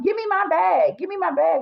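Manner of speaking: high, insistent demand; the other woman yapping, high and demanding. Retold or imitated. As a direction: mimic her yapping for her bag. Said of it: "she was like".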